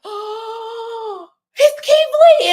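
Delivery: high, loud excited cry of a name. Retold or imitated. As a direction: shriek the name with sheer fan joy. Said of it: "I'm so excited".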